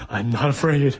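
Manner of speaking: mock frightened self-assurance; a boy under the covers telling himself he's not afraid; scared boy. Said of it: "shaking, going".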